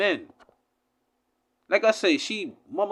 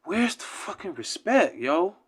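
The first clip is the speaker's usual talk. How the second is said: ordinary talk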